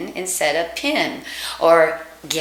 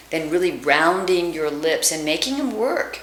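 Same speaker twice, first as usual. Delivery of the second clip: normal talking voice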